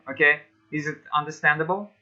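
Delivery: normal talking voice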